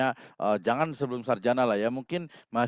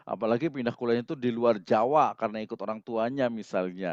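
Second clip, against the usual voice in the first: usual speaking voice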